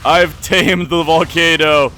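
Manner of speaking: loud, slow, high mad villain gloat; slow theatrical villain menace. Acted. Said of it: "see me turn this evil".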